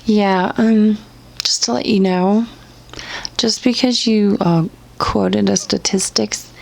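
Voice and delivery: usual speaking voice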